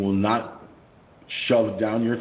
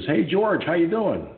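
friendly greeting; 9 semitones higher than usual. Retold or imitated. Member greets him on street.

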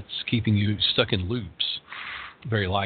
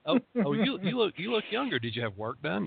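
backhanded compliment; 9 semitones higher, 6 dB quieter than usual. Retold or imitated. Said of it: "don't do left-handed compliments".

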